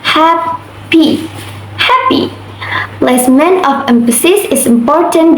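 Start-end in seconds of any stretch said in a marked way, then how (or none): 0.0-1.3 "happy" split slowly, HAP, then py; first-syllable stress. Shown as a demonstration. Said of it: "the first syllable is stressed". 1.8-2.3 "happy" with a high-pitched HAP; teacher's demo of HAP-py, first syllable lifted high; first-syllable stress. Shown as a demonstration. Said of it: "the first syllable is stressed".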